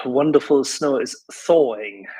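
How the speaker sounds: ordinary talk, nothing marked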